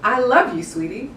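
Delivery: warm lift on love, gentle rise on sweetie; tender inflected I love you, sweetie; right way, inflected. Shown as a demonstration.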